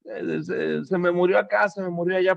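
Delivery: imitating people bemoaning their losses; mock lament. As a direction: wail like someone overdramatizing a loss. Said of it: "¿Por qué tanta gente".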